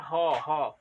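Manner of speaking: ह said twice, loud and deliberate; sounding out a letter. Shown as a demonstration. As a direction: breathe out ह clearly for the children. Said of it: "दूसरा अक्षर हमारे होठों से क्या निकल रहा है".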